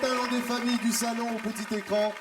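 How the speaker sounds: showman announcing. Acted. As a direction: greet the audience like a big game show. Host opening the show again.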